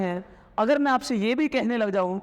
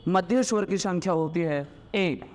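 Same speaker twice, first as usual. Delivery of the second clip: usual speaking voice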